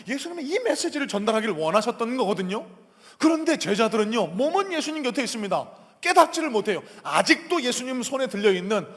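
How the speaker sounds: loud, high-pitched lament over the disciples; urgent pulpit exhortation; impassioned preaching. Real, not acted. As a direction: press the point forcefully on the congregation. Preaches at full force.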